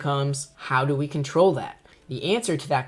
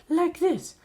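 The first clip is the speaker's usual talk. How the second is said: much higher hollow falsetto. Shown as a demonstration. Teacher demos the wrong falsetto girl voice, hollow and high. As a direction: squeak it up into falsetto on purpose. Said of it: "they very often will go into their falsetto, kind of hollow, real high voice".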